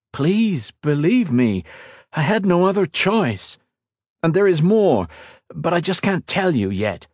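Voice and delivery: high, urgent plea; pleading. A narrator's reading. Ethan begs his angry fiancée to believe him, pleading. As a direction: beg to be believed.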